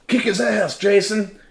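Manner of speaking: high, loud screech of encouragement; egging-on yell. Retold or imitated. Nadine urging him to fight the city man, high yell. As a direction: shriek it like a rowdy spectator. Companion eggs him on in confrontation.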